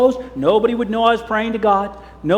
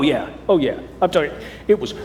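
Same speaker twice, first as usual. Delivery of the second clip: ordinary delivery, nothing marked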